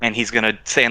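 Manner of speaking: ordinary talk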